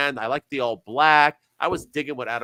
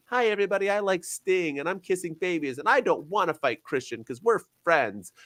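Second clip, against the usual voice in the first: higher, sugary good-guy parody; mock babyface voice; 6 semitones higher than usual. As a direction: play the goody-goody hero. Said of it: "It's not just him coming out and".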